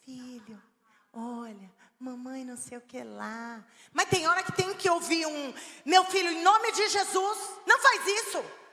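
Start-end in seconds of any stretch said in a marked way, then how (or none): 0.0-3.6 put-on much softer, gentle crouched-down voice to a child; tender hushed mom talking to her boy; soft tender mother. Shown as a demonstration. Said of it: "você vai agachar, fala no olhinho". 5.8-8.6 louder and higher, stern scolding call; mother firmly scolding her son in Jesus' name. Shown as a demonstration. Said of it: "tem que falar firme, tem que falar alto".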